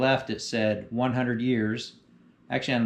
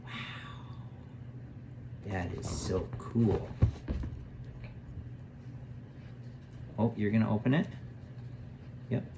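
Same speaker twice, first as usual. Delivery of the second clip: put-on reading to a toddler; 8 dB quieter than usual. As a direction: gasp and marvel for a little one. Lift-the-flap book with toddler.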